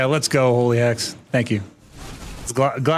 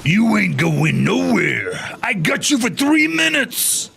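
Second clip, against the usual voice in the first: slow wrestler promo threat; slow, hammed Macho Man taunt; Randy Savage impression; 6 semitones higher, slower than usual. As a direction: stretch each word like a Macho Man promo. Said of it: "That's my Randy Savage".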